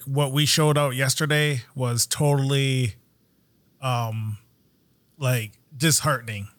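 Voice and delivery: low, sinking, halting dejection; bummed retelling. Retold or imitated. Host retelling a driver's view of yesterday, bummed. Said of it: "he was, he was bummed".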